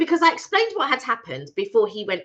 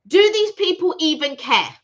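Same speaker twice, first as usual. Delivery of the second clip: higher, emphatic retold demand; indignant challenge; 4 semitones higher, 4 dB louder than usual. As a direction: press the question in indignant disbelief. Retold challenge to customer-service agent.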